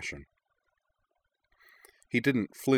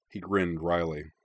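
usual speaking voice

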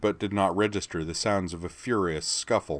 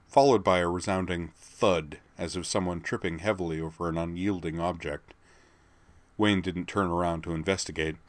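usual speaking voice

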